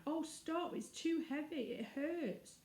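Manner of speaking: put-on high, pleading girl's cry; a small girl begging to be let out. Girl buried under heavy gold, high pleading cry. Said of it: "she cried out".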